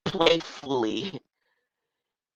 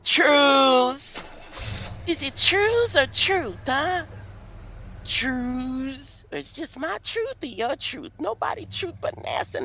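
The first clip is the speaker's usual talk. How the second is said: high mock voice; 12 semitones higher than usual.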